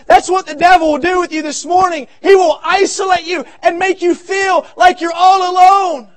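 loud, high preaching build, shouting the questions; preaching at full force. Acted. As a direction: press the congregation like a revival preacher. Pastor preaching to congregation.

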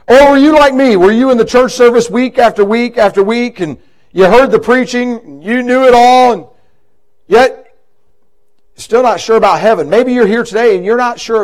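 loud, high appeal that climbs line by line; impassioned altar call appeal; rising preaching. Acted. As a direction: press the question hard on the congregation.

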